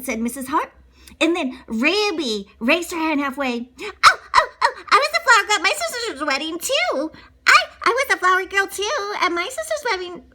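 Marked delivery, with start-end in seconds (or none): 3.8-10.2 put-on loud, high and fast eager little girl; a child blurting out to copy her classmate. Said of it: "Ruby said".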